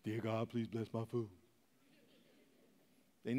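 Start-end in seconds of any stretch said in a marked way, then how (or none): 0.0-1.3 low, soft, slow mock grace; rote mealtime prayer. Churchgoer prays only at food time, perfunctorily. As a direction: mumble a token blessing. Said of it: "your only prayer is at food time".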